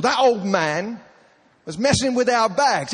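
high-pitched indignant tattling; acted accusing whine to a husband; disrespectful outrage. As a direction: complain loudly, imitating an aggrieved passenger. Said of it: "which was very disrespectful".

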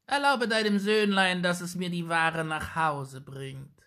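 put-on high old hag voice asking a favour; old witch voice. A narrator's reading. Old witch asking the mother to let her son carry the cabbages. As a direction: ask sweetly with hidden menace. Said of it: "rief die Alte".

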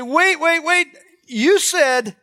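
high, loud interruption with a drawn-out protest; alarmed objection. Acted. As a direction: cut in loudly, pitched well up.

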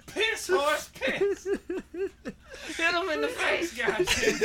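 put-on high, slow laughing old-timer; cackling old coot urging the horse; old prospector.

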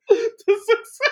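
high, squeaky-pitched laughing finish. Real, not acted. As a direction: barely get it out through laughter. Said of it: "let me read it again without laughing".

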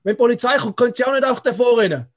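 high, loud stern inspector yelling after a runaway; officious inspector shouting. Retold or imitated. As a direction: bark like a self-important official. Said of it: "so gesagt".

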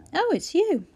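a little lift of surprise on seeing him; mildly surprised. A narrator's reading. Sick Clara spots Jonah at the door, lightly surprised.

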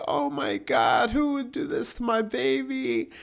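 imitated mother, climbing very high; mom freaking out. Mom hearing about the car, mock freak-out. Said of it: "my mom's freaking out".

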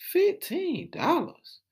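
high, slow, incredulous; drawn-out shocked question; disbelief. Real, not acted. Rapper shocked by a fifteen-dollar Hennessy, high and slow.

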